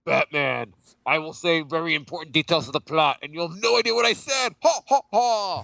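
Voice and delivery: hammed-up villain, climbing high; Bane. Villain taunting his masked-hero foe, hammed-up impression. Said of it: "do your best Bane voice impression?".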